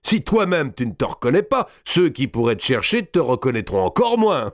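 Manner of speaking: high, theatrical mockery; gloating captor. A narrator's reading. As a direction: taunt the prisoner triumphantly. (geôlier triomphant et railleur)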